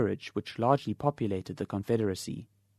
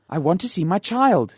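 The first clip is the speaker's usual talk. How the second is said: loud, higher insistent demand; 7 semitones higher, 8 dB louder than usual. Acted. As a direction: demand it firmly. Father demands to see child.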